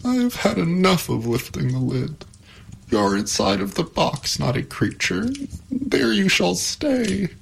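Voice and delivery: high, mildly tearful protest; starting to sob. A narrator's reading. Pandora telling the creature to stay inside, mildly tearful. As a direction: scold with a touch of tears. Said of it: "again beginning to sob".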